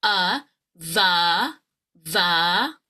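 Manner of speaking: "va" with an open short uh vowel. Shown as a demonstration. Coach models the short U, each sound separate and open. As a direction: drop the jaw on "Uh, va, va". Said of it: "jaw will drop for that short U".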